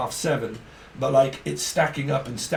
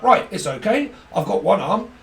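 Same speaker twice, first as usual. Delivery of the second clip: mock bravado; 6 semitones higher, 6 dB louder than usual. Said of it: "He's like".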